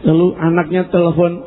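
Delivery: ordinary talk, nothing marked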